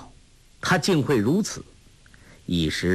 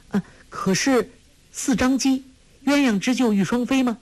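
much higher, rushing the question out; hurried startled question; 13 semitones higher than usual. Acted. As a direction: she suddenly recognises the poem. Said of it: "忙问".

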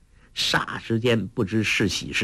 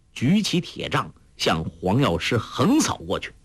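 usual speaking voice

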